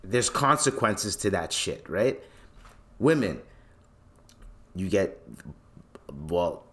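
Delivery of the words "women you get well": stumbling over the start, with halting restarts; stuttering. Real, not acted. Speaker losing the thread while explaining how women get looked at, stuttering. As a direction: trip over the opening words, then rush on. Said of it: "I don't know why I'm stuttering".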